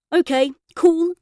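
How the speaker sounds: put-on boy needling his angry mother, cheeky; cheeky to irritate. A narrator's reading. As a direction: deliberately wind up the mum. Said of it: "I said just to irritate her".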